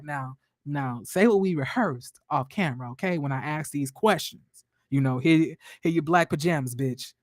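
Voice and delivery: a hint of a threatening boss off camera; imagined bossy Melody. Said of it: "Melody was probably like".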